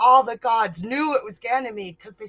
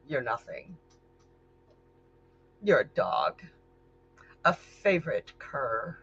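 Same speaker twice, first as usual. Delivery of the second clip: cold contempt; 4 semitones lower, 5 dB quieter than usual. Acted. Goddess belittling Ganymede.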